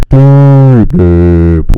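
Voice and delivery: drawn-out, booming bassy "deep"; super deep. Shown as a demonstration. Playing with the hard drive, exaggerated booming deep voice. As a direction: boom the word through heavy drive. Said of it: "super deep".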